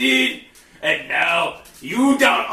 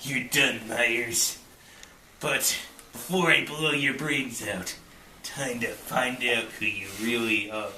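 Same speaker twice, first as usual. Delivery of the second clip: low, soft threat; quiet menace; 8 semitones lower, 9 dB quieter than usual. Acted. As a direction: menace him softly and low. Menacing before killing.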